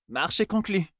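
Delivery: high, husky yet melodious female voice; husky melodious woman. A narrator's reading. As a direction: seal the deal in a husky, musical voice. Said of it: "une voix féminine rauque et pourtant mélodieuse".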